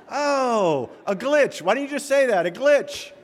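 high, loud burst of mock realization; excited realization. Young golfer finally getting the broken record idea, acted excited realization. Young man suddenly gets the analogy.

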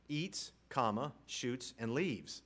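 eats, comma, shoots, and leaves said slowly with a pause after eats; slow, with each word set apart. Shown as a demonstration. Reading the badly punctuated manual entry slowly, comma audible. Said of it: "one misplaced comma".

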